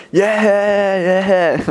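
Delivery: put-on high, drawn-out drunken whooping; imitated freaking out. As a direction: mimic a drugged-up guy freaking out. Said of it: "like, freaking out".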